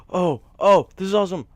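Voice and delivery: thrilled discovery; excited gasp. Real, not acted. Found a slime.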